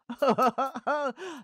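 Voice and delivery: high, loud chuckle; laughing fly. A narrator's reading. Freddy the Fly amused at Morris, laughing. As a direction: chuckle at the mess. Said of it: "he laughed".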